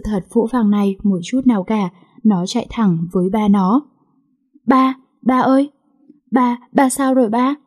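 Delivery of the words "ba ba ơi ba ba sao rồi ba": higher, tearful calling out; crying. A narrator's reading. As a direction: cry out to her dying father. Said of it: "Nó chỉ khóc và khóc". (gọi ba trong nước mắt)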